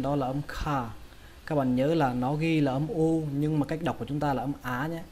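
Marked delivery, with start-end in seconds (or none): none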